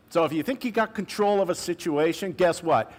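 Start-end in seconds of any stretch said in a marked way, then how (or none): none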